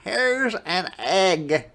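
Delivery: put-on high, loud, slow boy's call; excited Jack shout. A narrator's reading. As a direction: announce it like a proud kid. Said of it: "shouted Jack".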